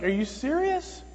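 high, slow disbelieving question; incredulous. Retold or imitated. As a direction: stretch it out in rising disbelief. Incredulous reaction to woman following him.

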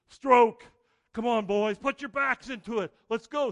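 coxswain bellowing stroke calls, high and loud; harsh barking commands. Acted. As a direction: shout the rowing count like a coxswain. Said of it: "assumes the role of the coxswain".

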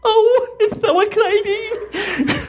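put-on high, loud, slow squealing girl with a laugh; hammed-up giddy girl, laughing; bad imitation of giddy girls. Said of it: "that was a bad imitation".

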